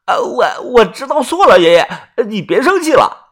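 high, stammering apology; tearful pleading. Acted. Grandson begging grandfather's forgiveness, tearful pleading. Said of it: "元宝红着眼说".

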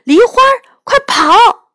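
high, loud shout of warning; loud calling out. Acted. Girl shouting at Lihua to flee the soldiers, loud high call. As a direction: yell to the cat to run now. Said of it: "我大声呼喊着".

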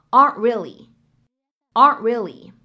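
"aren't really" slow and loud, the t held back, not released; incomplete plosion. Shown as a demonstration. Teacher stresses the unreleased t for the class. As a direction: swallow the t of "aren't". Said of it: "我们可以读成".

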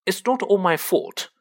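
lightly acted petulant comeback; retort. A narrator's reading. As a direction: push back indignantly. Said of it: "retorted Draco".

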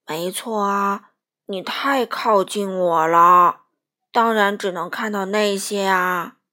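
deep whale voice. Acted. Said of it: "鲸鱼说".

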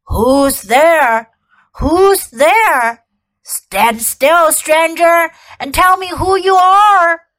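menacing guard wolf demanding answers; growling wolf. A narrator's reading. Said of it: "said in a growling voice".

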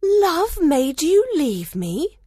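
very high, drawn-out rising question; slow, astonished repeat. A narrator's reading. Hermia echoes Lysander's words, slowly and astonished. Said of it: "she repeated slowly".

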